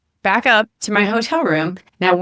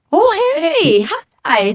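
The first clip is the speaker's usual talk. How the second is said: much higher, bright sing-song hello from the hotel guy; cheery greeting; 12 semitones higher, 3 dB louder, much slower than usual. Retold or imitated. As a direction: chirp a surprised hi across the room.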